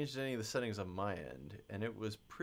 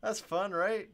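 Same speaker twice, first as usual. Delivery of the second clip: excited; 10 semitones higher, 8 dB louder than usual. Real, not acted. Pleased with his own math trick.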